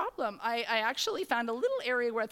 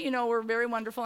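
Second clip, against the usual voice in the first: usual speaking voice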